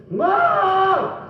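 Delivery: shouting Ma up high; calling up to the window. Retold or imitated. Child shouts up to his mother at the window, high call. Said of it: "it would go something like this".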